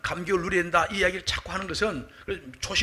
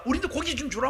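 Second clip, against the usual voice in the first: higher-pitched mock crowd complaint; protesting demand; 8 semitones higher than usual. People demanding meat from Moses, acted protest. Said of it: "막 백성들이 막 데모하는 겁니다".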